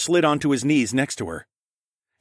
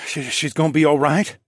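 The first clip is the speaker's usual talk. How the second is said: worried stammer, pitched up; trembling voice; 5 semitones higher, 4 dB louder than usual. Acted. Raccoon man asking the healer about Vel, trembling. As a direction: ask anxiously, voice trembling. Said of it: "asked in a trembling voice".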